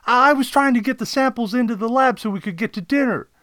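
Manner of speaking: high, fast, loud excuse; flustered sidekick explaining himself; assistant Kodet. Acted. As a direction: rush the excuse in a high-pitched voice. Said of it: "Kodet replied".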